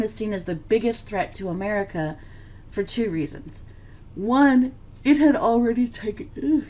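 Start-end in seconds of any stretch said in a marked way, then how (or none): 4.1-6.6 words stretched through a real yawn; a yawn breaking into the sentence. Real, not acted. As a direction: talk while fighting a yawn. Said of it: "I keep yawning".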